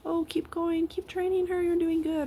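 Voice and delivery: high, bright cheer of encouragement; encouraging stranger. Retold or imitated. Dog owners cheering her on with training, high and encouraging. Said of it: "Most people are like".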